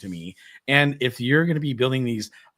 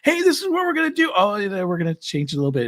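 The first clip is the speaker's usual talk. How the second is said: mock planners; 10 semitones higher, faster than usual. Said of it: "where it's like".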